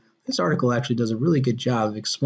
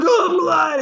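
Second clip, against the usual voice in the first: mock shriek; 14 semitones higher, 4 dB louder than usual. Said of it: "He's like".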